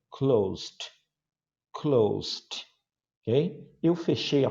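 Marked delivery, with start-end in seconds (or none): 0.1-2.7 "Closed" said as one syllable ending in st; teacher repeats "Closed" slowly with a final st sound; pronunciation demo. Shown as a demonstration. Teacher models it for students, a careful "Closed". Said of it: "Pronúncia, atenção".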